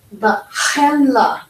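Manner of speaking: slow BA CHENLA with a throaty "kh"; ba KHEN-la, slow, rasped at the back of the throat; guttural ch. Shown as a demonstration. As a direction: scrape the start of chenla in ba chenla. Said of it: "Hear that ch?".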